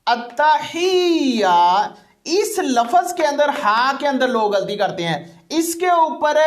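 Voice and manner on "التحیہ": التحیہ said very slowly with the ت doubled; slow spelling-out demo of التحیہ; joined syllables. Shown as a demonstration. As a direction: slowly join the letters into التحیہ. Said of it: "ملانا".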